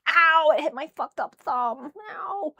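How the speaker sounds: real sharp pain cries. Real, not acted. Hit sore thumb.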